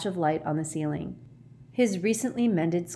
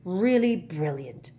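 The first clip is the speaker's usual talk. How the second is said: savage sarcasm; slower than usual. A narrator's reading. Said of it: "said Harry savagely".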